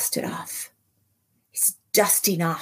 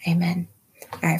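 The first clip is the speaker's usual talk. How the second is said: ordinary delivery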